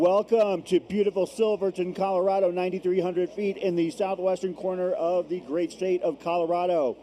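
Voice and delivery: bright, high welcome to the viewers; upbeat travel show host. Acted. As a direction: open the show like a cheerful TV host. Broadcast opening to viewers.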